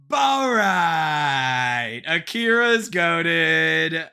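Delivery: loud, long drawn-out hype shout; hyped streamer shout. Pretend. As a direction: holler it slowly like a streamer catchphrase. Repeats streamer catchphrase, hypes viewer.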